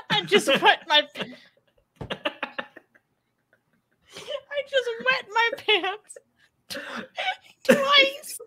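barely getting the words out between laughs; laughing while reading. Real, not acted. Host reads a viewer's chat comment, cracking up.

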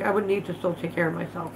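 ordinary delivery

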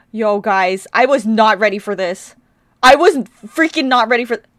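loud, overwhelmed outburst; stunned shout. Real, not acted. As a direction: exclaim loudly in fan shock. Stunned fan exclaims at the video.

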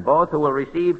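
usual speaking voice

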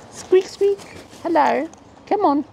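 very high squeaky pet voice; high falsetto coaxing; coaxing a bird. Real, not acted.

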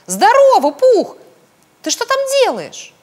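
high, loud greeting; excited shout. A narrator's reading. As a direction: call out loudly across a distance. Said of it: "закричал Пятачок".

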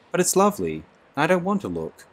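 Lenina, scared, refuses to look at the sea; distressed. A narrator's reading. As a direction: push back against him, upset.

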